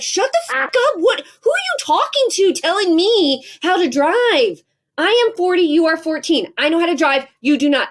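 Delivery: mother yelling back at her teenager about his driving, hammed-up fury; mock yell at teen. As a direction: shout down the teenager in exaggerated outrage. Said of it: "I get louder and louder and meaner".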